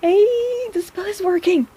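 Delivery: bright high burst of joy; thrilled it worked; delighted. Acted. Healer delighted the spell on your hand worked.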